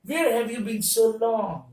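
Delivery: slow, tuneful, felt question; a slow question full of feeling. Shown as a demonstration. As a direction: ask it like you truly mean it. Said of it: "English में भी वैसी ही feeling आनी चाहिए".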